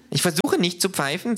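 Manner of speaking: high, mildly offended kangaroo; huffy kangaroo. A narrator's reading. Kangaroo corrects the narrator, lightly huffy. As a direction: sound a touch put out. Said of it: "sagt das Känguru eingeschnappt".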